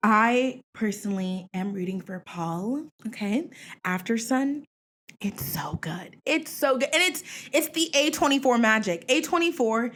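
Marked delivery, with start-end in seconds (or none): 5.2-6.2 soft unvoiced whisper of awe; hushed reverent whisper; whispered praise. Real, not acted. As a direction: whisper it like a precious secret. Whispered then repeated aloud.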